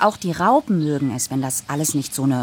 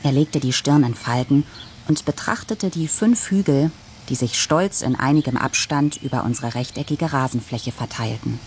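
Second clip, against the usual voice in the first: ordinary delivery, nothing marked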